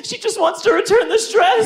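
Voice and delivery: high, fast imitation of mom. Mom at Macy's register returning a dress, high fast mock voice. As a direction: squeak it out quickly like a flustered mom. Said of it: "my mom is just like".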